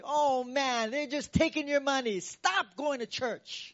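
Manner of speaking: high, loud scolding voice; nagging family member. Retold or imitated. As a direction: scold them to quit church. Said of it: "People in your family".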